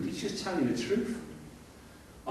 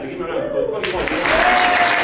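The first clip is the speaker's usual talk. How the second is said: proud proclamation; 4 semitones higher, 12 dB louder than usual. Real, not acted. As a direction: announce it to the crowd with pride. Proud announcement, calling applause.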